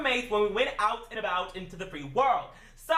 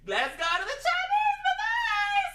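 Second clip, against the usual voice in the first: very high, drawn-out squealing cheer; hammed-up excited cry; slower than usual. Two roommates set off for the buffet, comically overexcited. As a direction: stretch it out in a mock-excited high holler.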